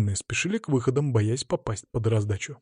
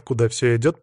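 usual speaking voice

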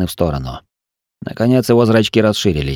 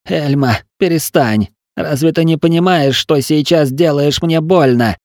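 higher, pained plea; pained pleading; 7 semitones higher than usual. Acted. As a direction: beg her to stop, hurting. Patient begs healer to stop.

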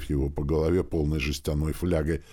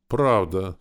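ordinary delivery, nothing marked

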